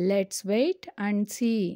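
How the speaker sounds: said slowly as one phrase; teacher saying the whole sentence slowly; slow model sentence. Shown as a demonstration. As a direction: read "Let's wait and see." slowly in one go. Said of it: "వేచి చూద్దాం".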